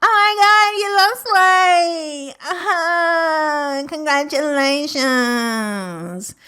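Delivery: high, squealing mock gush, slowed right down; over-the-top fake delight at a weight loss; gushing praise. Mocking people who praise her weight loss, gushing. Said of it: "people are, are like".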